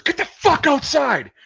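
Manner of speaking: high, loud, drawn-out bellow; drill sergeant yell. Retold or imitated. Drill sergeant throwing a private outside, yelling. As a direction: holler it as an enraged drill sergeant.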